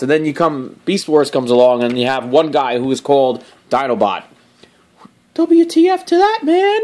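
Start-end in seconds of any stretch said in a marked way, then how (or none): 5.3-6.8 high, squeaky mock outrage.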